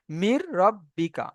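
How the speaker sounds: slow "মির রব্বিকা" on a held double r; "মির" leaning into the r of "রব্বিকা"; full merging. Shown as a demonstration. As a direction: stretch out "মির রব্বিকা" slowly, no n. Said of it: "একেই বলা হয় ইদগামে তাম".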